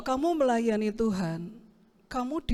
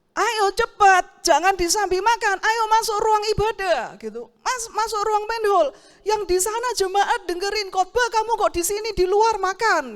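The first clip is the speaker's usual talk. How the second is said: bossy manager scolding; 8 semitones higher, 7 dB louder than usual. Retold or imitated. Service manager scolding the lighting volunteer for eating, high loud nag. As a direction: snap at him like a fussy boss.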